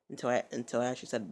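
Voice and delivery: ordinary talk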